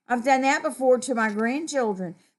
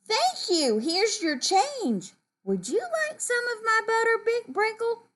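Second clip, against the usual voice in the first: high, sing-song polite voice; kind thanks; 13 semitones higher than usual. Acted. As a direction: thank and offer kindly, pitched way up. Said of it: "The kind thing to say would be".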